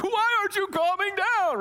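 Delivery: high-pitched exasperated cry; mock meltdown of a parent losing patience; frustrated parent. Dad getting emotional at his crying son, frustrated yell. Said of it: "starting to get frustrated".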